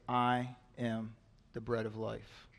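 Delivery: hushed slow restatement; slow solemn repeat. Acted. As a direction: let it settle, slowly and quietly. Jesus repeats the declaration.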